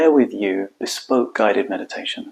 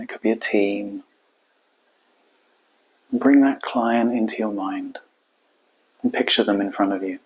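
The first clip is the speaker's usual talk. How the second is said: calm meditation guide, long unhurried pauses; guided meditation. Acted. Coach guiding listeners into their hearts, calm guided meditation. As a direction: guide them inward, leaving space between phrases.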